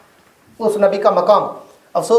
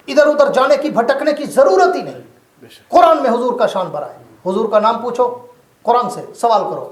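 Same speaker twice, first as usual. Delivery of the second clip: fiery preaching; 5 semitones higher than usual. Acted. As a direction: proclaim it to the whole hall. Preaching at full force. (ऊँची आवाज़ में जोशीला बयान)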